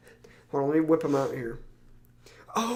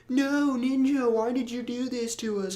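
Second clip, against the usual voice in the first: put-on whiny kid voice; 9 semitones higher than usual. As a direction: plead like a heartbroken kid. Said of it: "all these kids are like".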